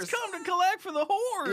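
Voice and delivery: very high-pitched exaggerated line reading; self-parody voice. Guest repeating his own parody line, absurdly high put-on voice. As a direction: echo the caricature way up high. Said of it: "your voice goes".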